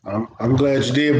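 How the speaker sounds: usual speaking voice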